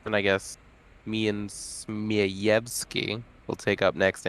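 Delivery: ordinary talk, nothing marked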